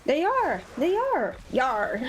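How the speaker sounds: high hammed-up pirate "They are, Yar". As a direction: play it like a cartoon pirate. Bananas joke, pirate "Yar.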